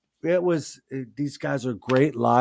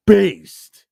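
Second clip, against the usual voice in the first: hyped shout; 6 semitones higher, 11 dB louder than usual. Real, not acted. Hyping the album.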